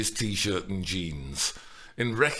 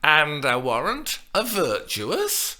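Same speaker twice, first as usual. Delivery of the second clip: slow, loud teasing drawl; dragged-out compliment; 6 semitones higher, 5 dB louder than usual. A narrator's reading. Nurse drags out praise, relishing Juliet's impatience. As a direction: relish the delay. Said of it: "dragged out the compliments".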